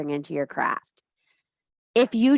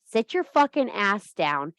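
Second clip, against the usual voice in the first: ordinary delivery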